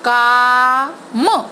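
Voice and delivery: काम stretched, आ held long; slow sounded-out word. Shown as a demonstration. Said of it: "इसलिए हम इसका sound तेज बोलेंगे". (काम में आ को लंबा खींचना)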